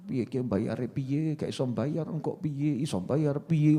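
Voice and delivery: slow, soft, low anxious mumble to oneself; fretful worrying. Retold or imitated. Debtor fretting over how to pay, slow soft worry. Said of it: "Orang mikir nyawang".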